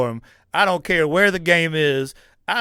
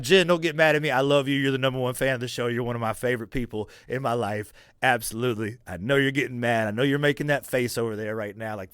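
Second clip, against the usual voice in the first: much softer, hushed sweet-talk; soothing apology; 9 semitones lower, 8 dB quieter, faster than usual. Real, not acted. Host soothing his fan Jen, soft hushed apology. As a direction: coax her gently so she won't be mad.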